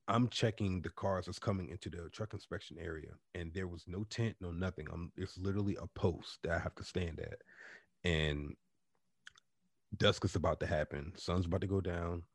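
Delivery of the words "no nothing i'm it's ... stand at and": ordinary delivery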